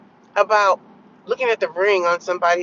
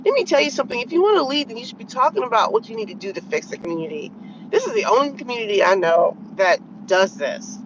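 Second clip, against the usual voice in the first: ordinary delivery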